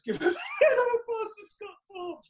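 words breaking into real laughter; laughing so hard the line nearly cracks. Real, not acted. Recalls sacking player on stage.

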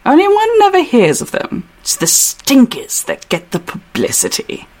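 put-on British character voice; Hutch accent. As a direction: begin high in an English accent, then drop. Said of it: "Hutch said".

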